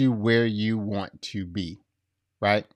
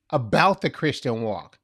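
ordinary delivery

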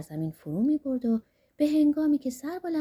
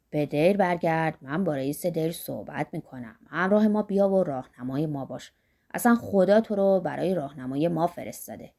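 put-on lightly lowered voice of an eager old man; Zorba's voice; 6 semitones lower than usual. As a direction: rub your hands over the gold, voice dropped low. Zorba persuades the monk.

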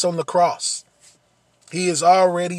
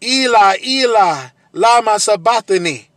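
anguished cry, much louder and higher; 4 semitones higher, 8 dB louder than usual. Acted. Jesus on the cross crying out to God, a loud anguished cry. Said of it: "cries out with a loud voice".

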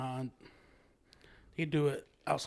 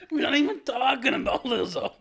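talking through laughter; laughing while getting words out; after laughing hard; 9 semitones higher, 6 dB louder than usual. Real, not acted. Laughing fit over [name].

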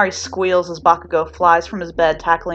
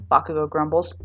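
plain reading voice

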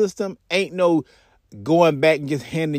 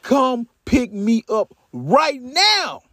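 urgent demand; 6 semitones higher, much slower than usual. Retold or imitated. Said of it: "he done called Davis".

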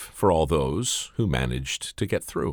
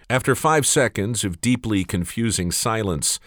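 plain reading voice

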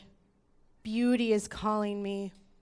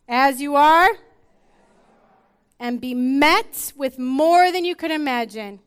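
loud, high, ringing call; full-force preaching; 4 semitones higher, 10 dB louder than usual. Acted. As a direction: call the whole room to the river. Congregation refrain, full force.

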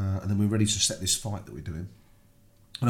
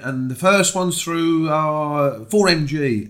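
drawn-out announcement, higher and louder; results announcer; 6 semitones higher, 6 dB louder, much slower than usual. Retold or imitated. Announcing which act is through in the blackout, drawn-out announcer. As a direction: lightly imitate a host stretching out a result.

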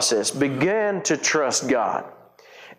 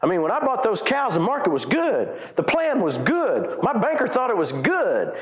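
normal talking voice

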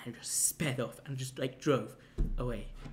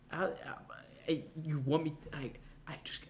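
breathless, horrified whisper; barely able to get words out; reliving the shock, a stunned whisper; 2 semitones higher, 3 dB quieter than usual. Retold or imitated. Horrified realisation.

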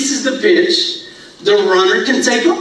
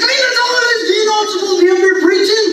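high, laughing protest; outraged, half-laughing retort; indignant disbelief; 9 semitones higher, faster than usual. Retold or imitated. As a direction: pastor hands the preacher a toilet job, indignant. Indignant at pastor's order.

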